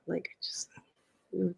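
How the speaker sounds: hushed, despairing whisper. Real, not acted. Cohost despairing about the world's future, whispering. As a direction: breathe it out, barely voiced.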